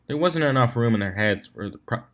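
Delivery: plain reading voice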